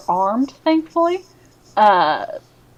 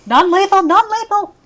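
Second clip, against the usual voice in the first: high, loud, frantic interjection; urgent cry; 10 semitones higher, 4 dB louder than usual. Acted. Game master blurting urgent call.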